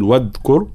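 واذكر said as "wadkur", with a stopped d; wrong hard d. Shown as a demonstration. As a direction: show the mistake: cut the ذ in واذكر to a d. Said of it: "فلا نقول".

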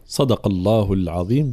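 usual speaking voice

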